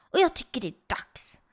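hushed, voiceless scheming; conspiratorial whisper. Acted. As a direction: whisper it like a secret plan. Secret plan to spend his V-bucks. (viskar konspiratoriskt)

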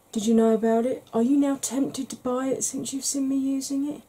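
usual speaking voice

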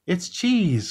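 high-pitched storybook exclamation; delighted high cry, not shouted; excited cry. A narrator's reading. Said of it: "cried Mouse".

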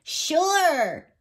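happy, high-pitched little badger; cheerful high "yes" from a young animal. A narrator's reading. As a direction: agree gladly, pitched high. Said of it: "nodded happily".

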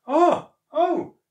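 two high, loud bursts; scornful exclamation. A narrator's reading. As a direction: exclaim sharply in indignation. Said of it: "the old man snored".

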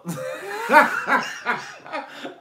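real helpless laughter bursting out high and loud; high breathless cackle. Real, not acted. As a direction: crack up completely, wheezing high. Cracking up at friend's fall.

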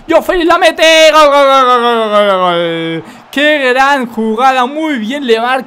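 loud goal yell fading off; breakneck goal commentary. Real, not acted. Second goal scored.

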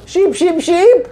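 high excited sheep chant; imitating Hans. Imitating Hans calling for a sheep, high and excited. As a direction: squeal it like a thrilled simple man. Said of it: "Hans says".

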